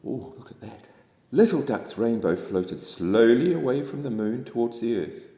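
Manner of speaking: ordinary delivery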